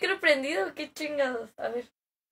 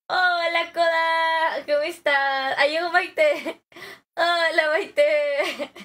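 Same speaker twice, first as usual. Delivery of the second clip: loud, raised, drawn-out welcome; excited greeting; 3 semitones higher, 5 dB louder, slower than usual. Real, not acted. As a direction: call out joyfully to friends arriving. Welcoming friends who just arrived. (saludando a gritos con emoción)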